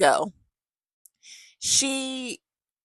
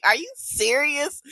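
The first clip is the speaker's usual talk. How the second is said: slow, high "Are you serious?"; disbelief; 8 semitones higher, slower than usual. Retold or imitated. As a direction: drag it out in stunned disbelief. Hears she asked for body wash.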